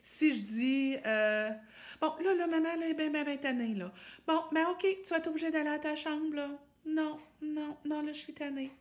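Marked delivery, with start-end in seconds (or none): 2.0-8.7 high, tired, plaintive voice; exasperated whiny mom. Shown as a demonstration. Mother sending Juliette to her room, high weary whine. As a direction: whine the punishment wearily. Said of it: "pas mieux non plus".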